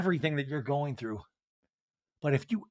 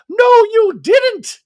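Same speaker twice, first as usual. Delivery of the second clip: much higher, slow, drawn-out mock Dorit voice; 11 dB louder, much slower than usual. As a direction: stretch the denial like a shrill caricature. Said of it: "Dorit says".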